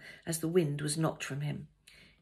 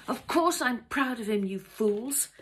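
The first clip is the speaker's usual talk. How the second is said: high, louder outburst at fools; imagined angry shout; 7 semitones higher, 6 dB louder than usual. A narrator's reading. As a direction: let pent-up anger burst out. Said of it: "shout at them".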